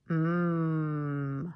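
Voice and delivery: hummed "Mm", held loud; m sound. Shown as a demonstration. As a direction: hum "Mm" with lips closed. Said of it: "An M says".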